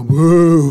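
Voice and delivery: long, loud boo; mock boo. Critic heckling metal bands on a plane, loud drawn-out boo. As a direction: boo heartily at the band. Acts out booing a band.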